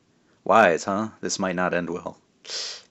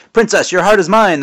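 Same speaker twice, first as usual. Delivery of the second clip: much higher and louder menacing cry; villain's scream; 11 semitones higher, 9 dB louder than usual. Acted. Man in brown screams at the princess, menacing. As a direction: yell it as you stab down. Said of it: "the man in brown screamed".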